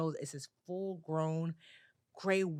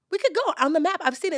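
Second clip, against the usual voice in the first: high, loud imitation of a little girl insisting; insistent child; 10 semitones higher, 8 dB louder than usual. Niece arguing Detroit is not far, insistent. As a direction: press the case like an eager kid.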